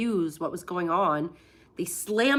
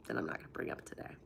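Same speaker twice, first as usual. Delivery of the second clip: quick unvoiced whisper; hushed aside; 13 dB quieter, much faster than usual. Real, not acted. Vlogger hushing a secret to chat, whispered. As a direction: whisper it fast so nobody hears.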